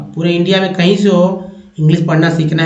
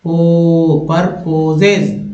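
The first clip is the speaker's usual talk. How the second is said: slow; much slower than usual. Shown as a demonstration. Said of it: "पी यू आर, पर".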